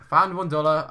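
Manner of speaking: sudden delighted exclamation; excited. Real, not acted. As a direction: blurt it out happily.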